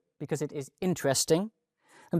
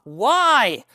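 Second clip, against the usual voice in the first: insistent why; 7 semitones higher, 6 dB louder than usual. Acted. Imagined questioner pressing a student.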